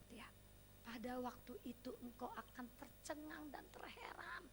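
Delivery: hushed, breathy-free recital of the verse; whispered scripture. Real, not acted. Preacher whispering the verse to the congregation, hushed. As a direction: murmur the promise softly.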